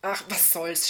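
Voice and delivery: halting, flustered answer; mildly stammered indecision; stammering. A narrator's reading. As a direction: waver between words, then give in. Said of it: "stammelte Lisa".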